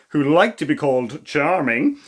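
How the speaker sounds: usual speaking voice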